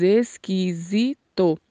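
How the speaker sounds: "esquisito" drawn out syllable by syllable, with a buzzed Z; slow "esquisito", voiced Z before the stressed vowel; Portuguese Z sound. Shown as a demonstration. Teacher demonstrates Portuguese for learners, slow "esquisito" with a Z. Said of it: "com som de Z".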